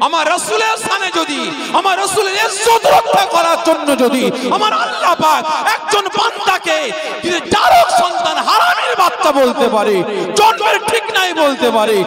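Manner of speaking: high, loud sermon climax; fiery preaching. Real, not acted. Preacher rousing the crowd in defence of the Prophet, fiery. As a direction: hammer home each phrase to the crowd.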